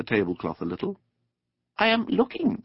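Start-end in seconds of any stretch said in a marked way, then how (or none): 1.8-2.6 high, small mole voice; mole's character voice. A narrator's reading. Mole answering his friend the rat, high little voice. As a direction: pitch it way up for a little animal.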